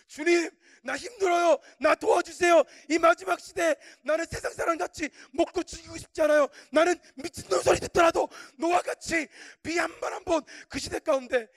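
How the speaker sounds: high, loud pleading cry; struggling, desperate prayer. Retold or imitated. Preacher begging the Lord for help, desperate prayer. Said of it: "주님 앞에 막 몸부림치면서 막 기도하는 거예요".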